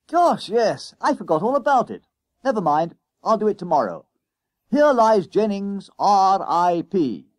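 put-on bully boy gloating about tomorrow's bashing; boastful schoolboy. A narrator's reading.

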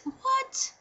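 very high, rising what; disbelief. Retold or imitated. Patient too blindsided to finish her sentence, disbelieving. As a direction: squeak out a baffled one-word question. Still shocked by doctor's baby news.

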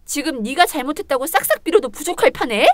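ordinary talk

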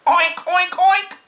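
high, loud oinking; boy's pig oinks. A narrator's reading. Boy tossing straw, high loud oinking. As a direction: squeal oinks like a child playing pig. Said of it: "cried Avery".

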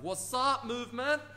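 high, bouncy mock cheer; playful dance greeting. Coach showing viewers how to dance off doubt, playfully high. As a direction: greet the moment like you're mid-dance. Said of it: "dance and be like".

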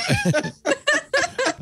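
bursts into a high delighted laugh; giddy high laugh. Real, not acted. Excited about the trip.

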